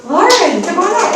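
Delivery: high, loud call across the room; host calling the winner up to the stage, high and loud. Real, not acted. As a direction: summon her up to the mic.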